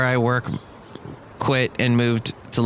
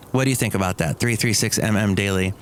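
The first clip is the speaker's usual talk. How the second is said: ordinary talk, nothing marked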